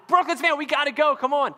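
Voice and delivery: high, loud hurried call; dad calling his kids off the play set. Retold or imitated. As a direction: urge the kids out, half shouting. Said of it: "And I'm just".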